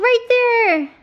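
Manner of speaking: pretend alarm. Pretend fire game.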